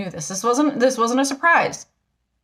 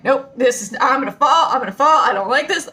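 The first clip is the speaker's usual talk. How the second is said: mock panic; 2 semitones higher, 4 dB louder than usual. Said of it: "and I'm like".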